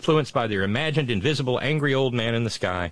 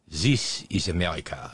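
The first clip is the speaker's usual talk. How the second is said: bad French accent; 6 semitones lower than usual. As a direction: mimic a French friend in a thin French accent. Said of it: "Sorry for the bad French accent".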